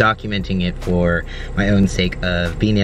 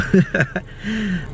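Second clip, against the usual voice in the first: light laugh; 14 semitones higher than usual. Real, not acted. Laugh after sharing secret.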